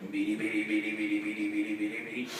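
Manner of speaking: low, soft patter of syllables; even, low babbled sound effect; imitated scurrying. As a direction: patter along quietly like little feet. Imitating Cub Scouts scurrying across.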